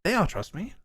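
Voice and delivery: high-pitched lord insisting on trust; self-important nobleman seeking agreement; vain coin lord, reassuring. Acted. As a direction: pitch it up, eager to prove he's trusted.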